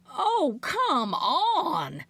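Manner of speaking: high, whiny protest; an old aunt wailing at being stopped; mock scream. Aunt Maxie protests from outside when the cop stops her. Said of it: "Aunt Maxie screamed".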